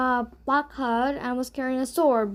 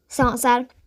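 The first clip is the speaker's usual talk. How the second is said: ordinary delivery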